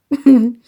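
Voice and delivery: short loud laugh. Real, not acted. Laughing burst.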